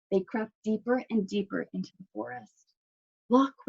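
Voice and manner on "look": usual speaking voice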